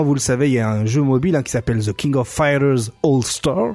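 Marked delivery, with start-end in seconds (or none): 1.8-3.8 English title in an over-accented delivery; heavy accent. Real, not acted. Host announcing a mobile game title, heavy accent. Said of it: "tout mon accent".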